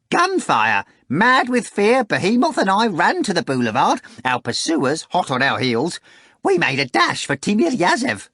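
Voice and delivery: high, loud breathless retelling; excited Korovyov. Acted. Korovyov telling Voland about the chase, high excited voice.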